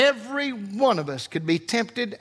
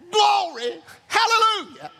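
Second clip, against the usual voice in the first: praise shout; 10 semitones higher than usual. Real, not acted. Preacher breaking off his sermon to praise God, exultant shout. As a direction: shout it out to heaven, high-pitched. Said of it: "Excuse me while I shout".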